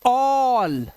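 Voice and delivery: ऑल with an open aw vowel, like English all; ऑल said as one clear syllable; ऑल like the English word all; correct reading. Shown as a demonstration. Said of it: "इसे पढ़ेंगे".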